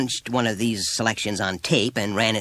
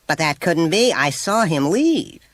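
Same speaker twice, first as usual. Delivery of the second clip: indignant; 10 semitones higher, 6 dB louder than usual. A narrator's reading. Mother indignantly denies.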